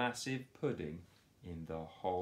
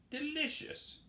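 much higher groan. Acted. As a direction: groan with full belly. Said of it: "groaned Morris".

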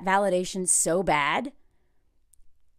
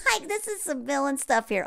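laughing, half crying; 3 semitones higher, 5 dB quieter than usual. Real, not acted. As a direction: let the laugh tip into a cry. Laughing at the scene.

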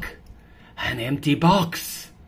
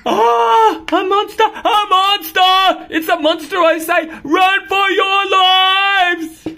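loud, high shout, slowing on the last line; hammed-up terror; panicked cat; 12 dB louder, slower than usual.

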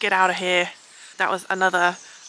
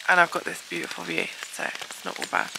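ordinary talk, nothing marked

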